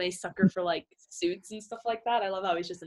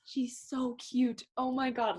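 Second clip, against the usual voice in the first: swooning; 4 semitones higher, 5 dB quieter, slower than usual. Retold or imitated. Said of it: "I was like".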